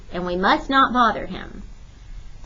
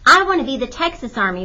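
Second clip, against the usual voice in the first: put-on fast, insistent little girl; eager girl voice; 4 semitones higher, 5 dB louder, faster than usual. A narrator's reading. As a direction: push for her turn, quickly. Girl demands her turn.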